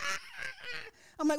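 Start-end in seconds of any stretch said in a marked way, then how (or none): 0.0-0.2 high, drawn-out mock shriek; imitated scream of pain. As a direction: shriek like a hurt victim, hammed-up. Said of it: "And she's like".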